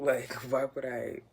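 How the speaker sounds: slow, loud, laughing through it; laughing at mom's question; laughing denial. Retold or imitated. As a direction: laugh off the idea.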